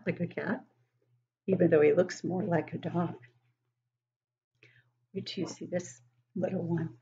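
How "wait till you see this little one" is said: whisper. Real, not acted. Teasing the next page to kids.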